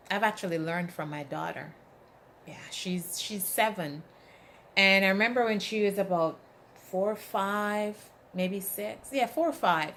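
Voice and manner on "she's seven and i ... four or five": normal talking voice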